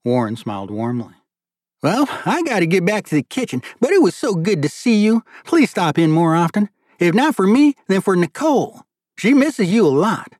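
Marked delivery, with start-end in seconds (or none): 1.8-10.3 put-on high, loud, animated father in a thick accent. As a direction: plead fondly, pitched well up, in a thick accent. Voices Warren, the father, in dialogue.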